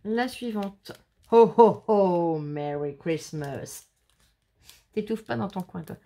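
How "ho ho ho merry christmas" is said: booming ho-ho-ho falling into a long, slow greeting; jolly Santa ho-ho-ho. Pretend. Crafter reading a Christmas card aloud, hammed-up Santa. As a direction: play Santa, loud start, drawn-out lower finish.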